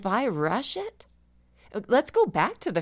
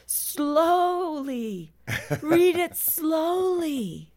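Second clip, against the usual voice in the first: high, slow, drawn-out call of slowly; yelled out; 11 semitones higher, much slower than usual. Retold or imitated. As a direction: call out to the readers like an insistent tour guide. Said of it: "he would yell out".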